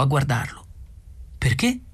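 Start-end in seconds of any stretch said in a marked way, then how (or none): 1.4-1.8 higher-pitched, puzzled rise; puzzled question. A narrator's reading. Arthur asks Ford why he would have a towel, puzzled. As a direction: ask it with a rising lift.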